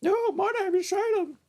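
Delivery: very high put-on puppet voice; high squeaky impression of the other host; mimicking co-host. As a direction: squeak it out as your friend. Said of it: "now I gotta put on your voice?".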